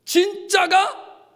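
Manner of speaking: very high, marveling cry; acted awed announcement. Onlookers spotting a true person of God, acted awed cry. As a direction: proclaim a long-awaited arrival, stretching the last word. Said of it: "우리 성도들을 보면서".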